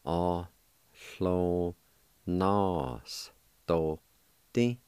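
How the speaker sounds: very slow, drawn-out Ohlonostoti; slow syllable by syllable. Shown as a demonstration. Teacher slowly demonstrating Ohlonostoti to learners. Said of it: "it's pronounced like this".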